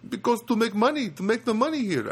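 put-on a light, lifted Filipino accent for the old guard. Guard explaining why he works here, in a mild Filipino accent. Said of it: "I'll do my best".

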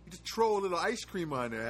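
"tro" said with a plain t, no th; t in place of th in "tro"; neighborhood pronunciation. Retold or imitated. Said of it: "you guys from my neighborhood, you say tro".

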